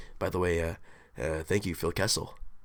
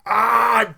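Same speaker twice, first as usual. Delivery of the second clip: imitating a hurt player's cry, higher and louder; a quick retold yelp of pain; brief hammed-up cry of injury; mock pained yell; 8 semitones higher, 6 dB louder than usual. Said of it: "just going, like".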